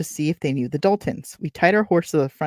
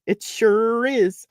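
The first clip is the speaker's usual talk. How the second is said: drawn-out, higher-pitched attempt at British; British accent; 7 semitones higher, much slower than usual. Reader voicing the man at the farmhouse, British accent. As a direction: try a British accent, high and slow. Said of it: "This man has a British accent".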